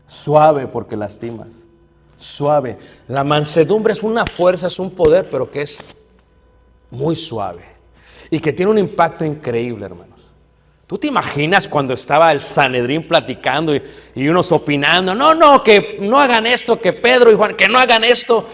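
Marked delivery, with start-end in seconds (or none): 0.1-2.8 put-on calm a boy down tenderly; gentle wife. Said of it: "mi esposa siempre le decía". 15.2-18.5 agitated council. Retold or imitated. Sanhedrin members arguing.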